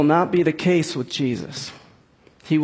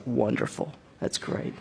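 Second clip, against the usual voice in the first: low, quiet deadpan sarcasm; dark sarcasm; 7 semitones lower, 10 dB quieter than usual. Shown as a demonstration. To the dog that scattered trash all over the house, darkly sarcastic. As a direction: mutter it drily, much softer and lower. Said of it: "it's with dark sarcasm".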